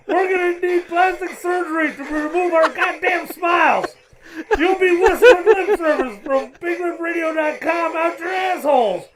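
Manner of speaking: high, ranting voice through a mask; Darth Vader voice. Acted. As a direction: rant like a helmeted movie villain. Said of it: "I'm gonna be doing Clark with, uh, Darth Vader's voice".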